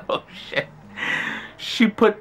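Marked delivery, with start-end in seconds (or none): 0.1-0.7 whispered oh shit. Retold or imitated. Scared whisper to himself.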